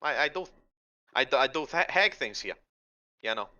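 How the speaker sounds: a hint of Boston-accented hag; night hag at home. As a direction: chat hesitantly as an old night hag.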